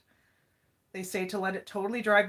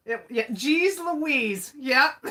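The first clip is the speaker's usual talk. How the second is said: laughing; 4 semitones higher, 5 dB louder, slower than usual. Real, not acted. Laughing catchphrase exclamation.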